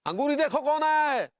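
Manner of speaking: high, drawn call through the house. Acted. Husband asks wife to see who came, calling out. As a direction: call to someone in another room.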